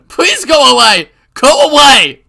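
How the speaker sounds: high, very loud screaming; terrified scream. Acted. Keiichi screaming at Rena through the door, terrified scream. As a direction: scream her away in panic.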